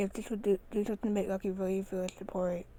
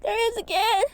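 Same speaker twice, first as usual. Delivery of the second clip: high excited yell; 13 semitones higher, 6 dB louder than usual. A narrator's reading. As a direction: shout it out, startled. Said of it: "yelled Roly".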